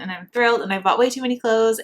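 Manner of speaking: usual speaking voice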